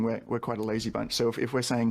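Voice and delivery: ordinary talk, nothing marked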